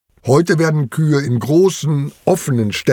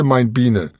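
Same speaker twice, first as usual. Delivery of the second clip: plain reading voice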